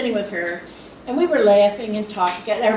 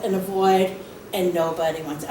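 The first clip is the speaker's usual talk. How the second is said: ordinary delivery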